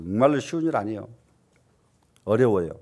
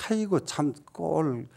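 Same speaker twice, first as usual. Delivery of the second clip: scornful sigh; 8 dB quieter, slower than usual. Pretend. Voicing a disgusted in-law.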